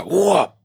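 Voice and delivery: quick, high "whoop" effect; imitated dagger whoosh. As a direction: voice the swift stab as a noise. Imitates dagger being drawn.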